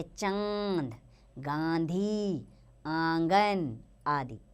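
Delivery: put-on teacher sounding out चांद for children; slow nasal demo of चांद गांधी आंगन; nasalised vowels shown slowly. Shown as a demonstration. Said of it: "इसका उच्चारण नाक और गले दोनों से होता है".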